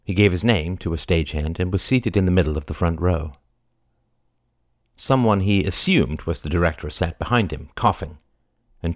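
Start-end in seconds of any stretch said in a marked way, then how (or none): none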